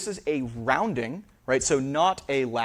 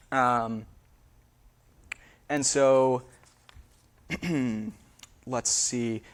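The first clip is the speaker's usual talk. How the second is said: normal talking voice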